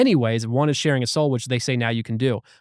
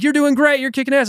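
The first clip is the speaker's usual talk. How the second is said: high, loud encouragement; mock concert cheer; 11 semitones higher, 4 dB louder than usual. Past selves cheering on a man on stage, high mock cheering. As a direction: hype them up like a fan in the crowd. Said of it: "Like he's at a concert and he's on stage".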